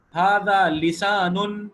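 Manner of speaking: ھذا فم stretched out very slowly and loudly; slow model reading. Shown as a demonstration. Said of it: "یہ سارا ھذا کے ساتھ ہے".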